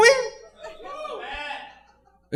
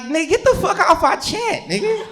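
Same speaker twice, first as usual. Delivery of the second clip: high-pitched incredulous outburst; mock outraged yell; 13 semitones higher than usual. Comic reacting to an old man in the group chat, high incredulous squeal. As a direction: squeal it out like you can't believe it. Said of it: "I'm like".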